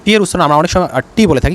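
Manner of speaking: ordinary talk, nothing marked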